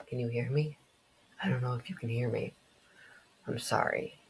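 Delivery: soft, hushed voice; quiet apology. Acted. Brother apologising at the bedside, soft and hushed. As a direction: speak gently, fading towards a whisper.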